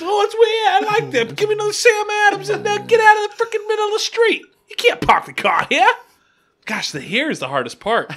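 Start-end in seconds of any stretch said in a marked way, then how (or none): 0.0-5.9 very high, loud comic Boston rant; mock Boston yell, pitched way up; Boston accent. Shown as a demonstration. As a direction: rant like a hammed-up Boston local. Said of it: "worst or the best accent".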